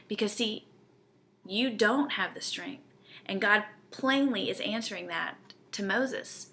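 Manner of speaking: ordinary delivery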